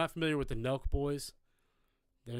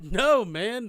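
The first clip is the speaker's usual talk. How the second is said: high, loud protest; exasperated mock shout; 10 semitones higher, 11 dB louder than usual. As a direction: cry out in hammed-up disbelief. Retold hosts dying laughing at misspelling.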